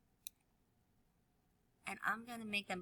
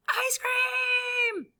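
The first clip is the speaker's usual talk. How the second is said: high, loud shout; excited shout; 6 dB louder than usual. Acted. Piggy shouting for ice cream, high and loud. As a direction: yell it with pure joy. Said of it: "he is also going to say".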